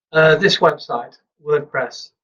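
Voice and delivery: ordinary delivery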